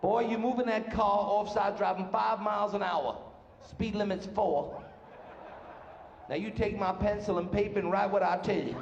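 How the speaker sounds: slow mock Southern lawman; hammed-up Southern patrolman, drawling it out; Alabama patrolman. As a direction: drag the words like an Alabama highway cop. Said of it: "Highway patrolmen".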